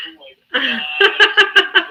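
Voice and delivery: very high, loud, slow laughing cry; laughing shock. Retold or imitated. Fan reacting to the singer's son, laughing squeal. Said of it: "And I'm like".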